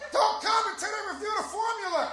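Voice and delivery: high, loud insistent; Jasmine shushing. Retold or imitated. Jasmine scolding him during the show, high and loud. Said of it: "She's just going".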